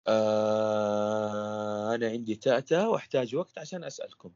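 very long, level "آه" sliding into "أنا"; prolongation technique. Shown as a demonstration. Coach demonstrates telling people about a stutter, drawing out the first "آه". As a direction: hold the opening "آه" on one low note, then continue normally. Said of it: "تكنيك المد".

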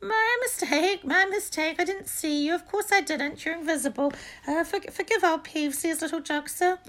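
very high, loud, fawning poltergeist; greasy. A narrator's reading. Peeves apologising to the supposed Baron, greasy fawning. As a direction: grovel in a squeaky, oily voice. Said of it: "he said greasily".

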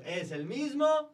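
high, slow drawn-out lead-in; show-host cue. Pretend. Host cueing everyone to answer together, sing-song call. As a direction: stretch it loudly to cue the crowd.

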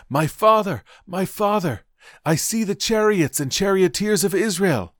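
high, loud cry of alarm and awe. A narrator's reading. Elisha watching his master carried into heaven, crying out. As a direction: cry out high, holding the pitch up. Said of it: "cried out".

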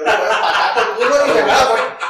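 laughing shout. Retold or imitated. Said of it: "Y yo".

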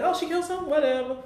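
high, careless shrug of a voice; dismissive. Retold or imitated. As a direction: brush it off lightly. Dismissive quoted doctor.

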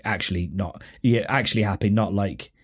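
ordinary talk

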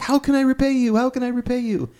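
very high-pitched pleading imitation; mock desperate gratitude. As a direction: play an over-eager man begging to return a favour. Acted grateful man banging on window.

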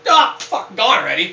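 high, loud mock swear after losing; mock gambler curse. Mocking a gambler who lost it all, loud. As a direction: mimic a loser's groan of a curse. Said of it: "they're like".

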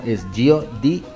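usual speaking voice

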